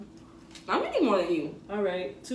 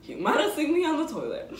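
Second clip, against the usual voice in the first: laughing; 6 semitones higher, 4 dB louder than usual. Real, not acted. Laughing at own joke.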